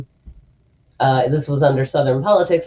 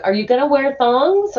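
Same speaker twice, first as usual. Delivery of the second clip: high-pitched mom question; lightly voiced mom, pitched well up; imitating mom; 10 semitones higher than usual. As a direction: play an innocently asking mom. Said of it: "she's like".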